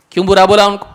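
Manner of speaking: ordinary talk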